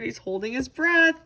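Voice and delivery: ordinary delivery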